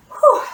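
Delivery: high loud relieved exhale; relieved whew. Real, not acted. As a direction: blow out after the effort. Exhale after workout.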